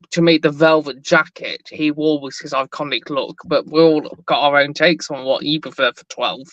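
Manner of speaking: ordinary delivery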